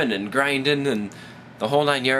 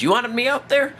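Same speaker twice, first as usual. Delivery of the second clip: put-on Southern woman, starting high; sassy mock Southern gal; southern accent; 6 semitones higher than usual. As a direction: lay down the law in a Southern accent. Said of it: "didn't really have a southern accent".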